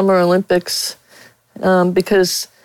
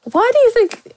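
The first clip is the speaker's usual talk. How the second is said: high, pointed question; retold confrontation. Reporter retelling her question to the athlete, high and pointed. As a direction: ask it with mock sweetness. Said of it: "and I said".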